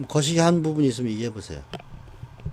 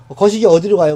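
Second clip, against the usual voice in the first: loud, playful Jeolla-style question with 거시기; Jeolla dialect demo; 3 semitones higher, 10 dB louder than usual. Shown as a demonstration. Teacher demos Jeolla slang to his students, loud and playful. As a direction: toss off 거시기 like a Jeolla local asking the way. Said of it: "모르면 거시기 하면 돼요".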